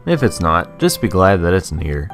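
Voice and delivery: usual speaking voice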